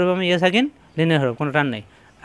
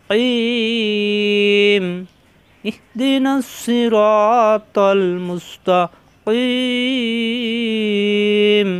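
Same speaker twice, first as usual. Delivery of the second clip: slow, drawn-out chanted recitation; Quran recitation; 3 semitones higher, much slower than usual. Shown as a demonstration. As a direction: recite with long tajweed stretches. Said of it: "teacher recites the verse as model".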